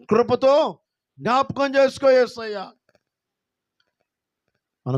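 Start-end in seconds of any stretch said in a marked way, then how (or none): 0.1-2.7 high, loud cry for mercy; high pleading prayer. Acted. Praying believer calling to Jesus, high plea.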